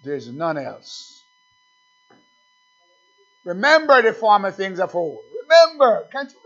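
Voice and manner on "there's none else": hushed. Acted. Sudden hushed drop after shout.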